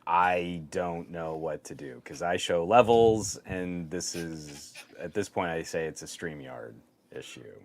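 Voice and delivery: audio dropouts; audio dropping out. Real, not acted. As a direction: voice cutting in and out. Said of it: "StreamYard's messing up the audio on [name]'s levels".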